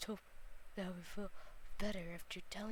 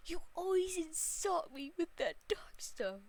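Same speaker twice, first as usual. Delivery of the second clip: hurt reproach; 8 semitones higher than usual. Acted. Character confronts Kaiba, hurt.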